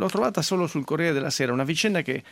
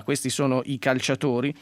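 ordinary talk, nothing marked

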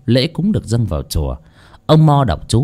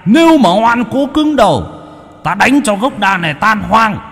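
much higher shouted god voice; thunderous shouting deity; 10 semitones higher than usual. Acted. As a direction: roar the threat down from the sky. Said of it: "mà quát".

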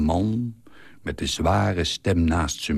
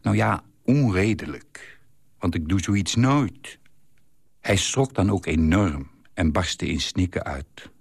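ordinary delivery

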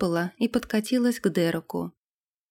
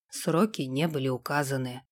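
low, softer, even voice; composed retainer Sam; 6 semitones lower, 6 dB quieter than usual. A narrator's reading. Sam confesses a royal order to the lord, composed. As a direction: report it quietly and impassively. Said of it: "Пожал плечами Сэм".

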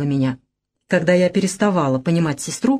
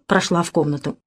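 ordinary delivery

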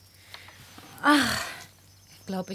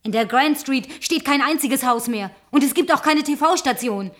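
angry accusation; 2 semitones higher, 7 dB louder than usual. Acted. Angrily exposing his lies.

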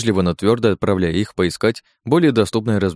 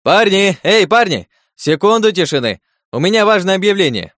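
loud, high shout to the room; calling out over crowd; 11 semitones higher, 5 dB louder than usual. Acted. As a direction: call the whole room to attention. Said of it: "над весёлым гомоном разнёсся его голос".

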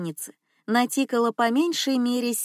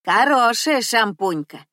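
put-on loud old cleaner; 2 semitones higher, 6 dB louder than usual. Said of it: "одобрила баба Нюся".

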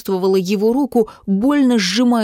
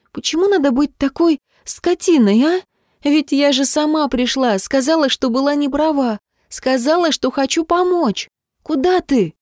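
high-pitched, wounded reproach; upset protest; 7 semitones higher than usual. A narrator's reading. Girl reproaching the man walking off, upset. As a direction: pour out the grievance in a raised pitch.

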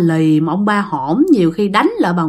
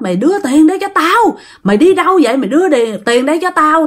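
cursing husband; 6 semitones higher, faster than usual. Acted. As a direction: bellow for the money. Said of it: "nghe cái tiếng của ông này chửi".

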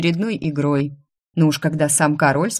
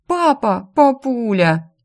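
pleading daughters; 7 semitones higher, 3 dB louder, slower than usual. A narrator's reading. Daughters protest to father.

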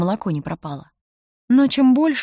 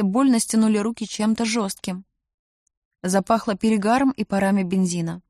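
usual speaking voice

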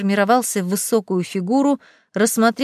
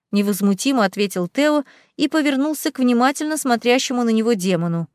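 ordinary delivery

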